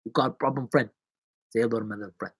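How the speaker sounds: low-key attempt at a movie gangster accent; soft, half-hearted gangster accent; Scarface. Shown as a demonstration. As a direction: mumble a tentative gangster accent. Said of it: "Pacino does an accent in Scarface".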